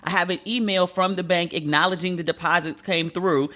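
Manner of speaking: normal talking voice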